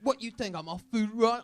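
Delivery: high-pitched British parody; mock British accent. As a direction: play an offended English waiter. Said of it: "make us sound like Oliver Twist".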